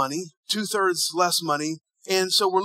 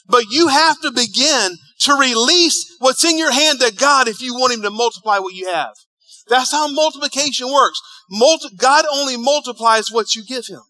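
full-force preaching; 4 semitones higher, 6 dB louder than usual. Real, not acted.

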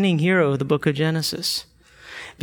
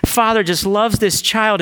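usual speaking voice

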